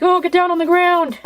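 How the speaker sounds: high, put-on cop command; mock police order. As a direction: imitate cops calling out an order. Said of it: "they're all like".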